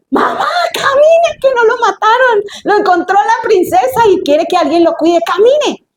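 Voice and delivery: very high, urgent call to mother; excited calling out. Acted. Girl calling her mother with good news, excited and urgent. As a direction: stretch the first call, then hurry her along.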